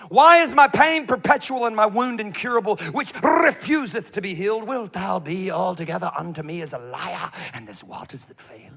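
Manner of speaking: mock prophet accent, loud and high, sinking to faint; Jeremiah. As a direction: declaim in a silly Old Testament prophet accent, fading out. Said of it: "Why did you read it in that stupid accent?".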